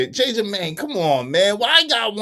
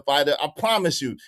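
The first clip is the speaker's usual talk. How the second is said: ordinary talk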